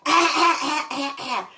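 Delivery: high, loud mock coughs that fade out; hammed-up retold coughing; imitated coughing fit. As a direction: cough it up theatrically, then trail off. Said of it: "And she".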